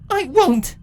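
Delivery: defiant captive yelling no. Acted. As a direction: refuse with everything. Twilight defiant cry.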